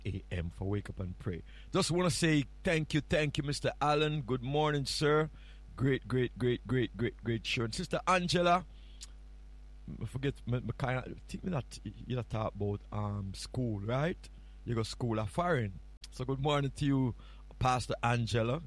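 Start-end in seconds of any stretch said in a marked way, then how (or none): none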